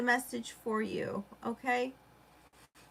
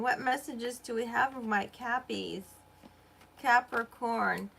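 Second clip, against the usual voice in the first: ordinary delivery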